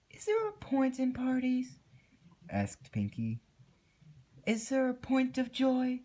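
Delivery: very high, steady, hollow voice; eerie Pinkie. A narrator's reading. Creepy Pinkie asking Twilight about joy, very high and hollow. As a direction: ask it like nothing matters anymore.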